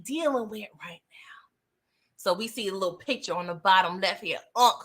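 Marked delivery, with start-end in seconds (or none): 0.0-1.5 hushed whisper; conspiratorial whisper to the audience. Real, not acted. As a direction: whisper it like a secret. Ominous aside to viewers.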